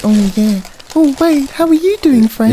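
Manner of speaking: high, loud sugary greeting; gushing high voice. Retold or imitated. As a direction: gush over a friend, pitch way up. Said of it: "One week he was absolutely like".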